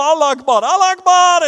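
loud, high, insistent cry; repeated shout. Retold or imitated. Seatmate on the plane starts shouting, loud repeated cry. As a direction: shout it over and over. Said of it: "han begynner å rope".

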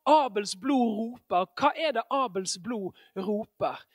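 ordinary delivery

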